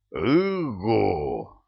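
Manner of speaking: booming guard hoot; a hint of a droning hoot; low hoot. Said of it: "прогудел".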